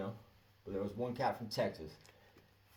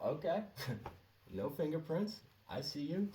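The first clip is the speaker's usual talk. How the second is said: higher, changed-voice teasing; knowing tease; 7 semitones higher than usual. Retold or imitated. Manager teasing him about no fingerprints, higher and changed.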